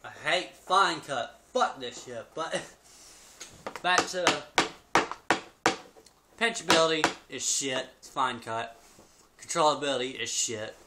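talking with tobacco in lip; lip full of dip. Real, not acted. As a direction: mouth full of fine cut dip. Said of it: "Gets all in your mouth when you're talking".

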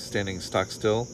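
plain reading voice

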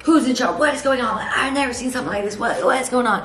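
mock outraged neighbor. Old lady with her dog confronts the clothing sale, mock outraged. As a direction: act out a neighbor demanding answers.

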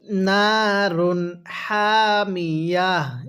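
নারুন হামিয়া drawn out slowly; teacher model reading, very slow; slow recitation demo. Shown as a demonstration. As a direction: stop on হামিয়া with a held ending. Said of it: "আরেকবার আমার সাথে পড়ুন".

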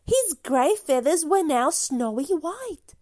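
usual speaking voice

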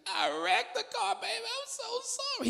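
high, teary, sobbing apology; crying wife. Retold or imitated. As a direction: whimper it like a wife who just wrecked the car.

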